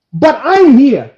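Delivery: loud, high, drawn-out protest; indignant protest. Retold or imitated. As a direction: insist loudly, re-enacting the row. Retold quarrel in a shop.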